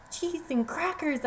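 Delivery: high, slow startled cry; frightened exclamation. Acted. Mouse cries out in fright at the lion. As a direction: blurt it out in fright. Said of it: "I exclaimed out of fright".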